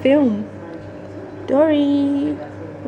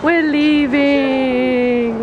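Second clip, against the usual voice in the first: very slow, stretched-out words; drawn-out announcement; much slower than usual. Pretend. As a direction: drag out each syllable grandly. Drawn-out announcement, then plain.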